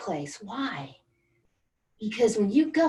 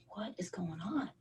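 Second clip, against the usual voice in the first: voiceless, shocked whisper; stunned whisper; 13 dB quieter than usual. Retold or imitated. Remembering sitting frozen in her car after the diagnosis, stunned whisper. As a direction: breathe it out in disbelief. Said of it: "I just sat there and was like".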